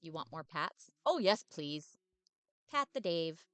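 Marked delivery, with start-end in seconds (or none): 1.0-3.4 loud, bouncy, overexcited puppy; eager dog voice. Acted. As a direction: bark every word out like a happy dog. Dave enjoys being petted.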